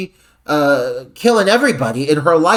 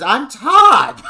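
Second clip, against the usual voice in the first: high, loud cry of hammed-up outrage; mock offended shout; 11 semitones higher, 6 dB louder than usual. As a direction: shout it in pure offense, half laughing. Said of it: "with a look of pure offense and says".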